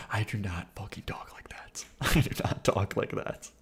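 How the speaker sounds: half-whispered protest under the breath; breathy hushed denial through a laugh. Real, not acted. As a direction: mutter it quietly, laughing it off. Denies talking like the clip.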